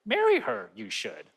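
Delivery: mock Yoda wisdom. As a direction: croak it like Yoda giving advice. Who is your Yoda.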